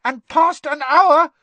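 high, loud elf squeak; squeaky house elf. Acted. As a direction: squeak it out urgently. Dobby voiced.

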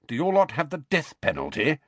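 lifted, hopeful query; hopeful afterthought. A narrator's reading. Uncle asking nephew about his punishment, hopeful afterthought. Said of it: "he added as a hopeful afterthought".

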